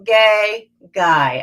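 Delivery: bored drop down the scale. Shown as a demonstration. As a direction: sound like a kid who can't be bothered. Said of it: "turn that into some bored".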